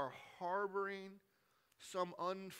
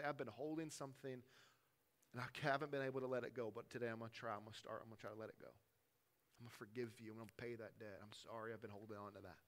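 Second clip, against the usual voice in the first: quiet confession; 5 semitones lower, 7 dB quieter, faster than usual. Shown as a demonstration. Modelled confession to someone.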